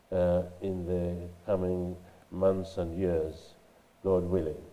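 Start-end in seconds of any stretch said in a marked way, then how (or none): none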